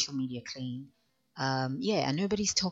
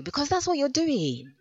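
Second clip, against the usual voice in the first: pointed jab; 12 semitones higher, 6 dB louder than usual. Real, not acted.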